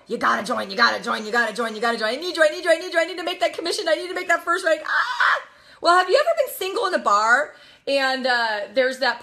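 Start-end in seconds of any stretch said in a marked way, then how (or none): 0.1-2.1 low, rapid pestering chant; predatorial recruiter. Acted. Recruiter hounds people to join, predatorial and pushy. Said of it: "first are very predatorial". 2.1-5.4 fast pleading, building to a yell; desperate recruiter. Acted. Recruiter begs people for a commission, frantic and needy. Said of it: "you're desperate".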